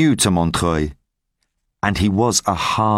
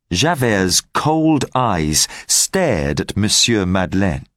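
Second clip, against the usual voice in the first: ordinary delivery, nothing marked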